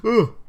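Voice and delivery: long tired yawn; a real mid-sentence yawn; yawning groan. Real, not acted. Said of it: "I just yawned".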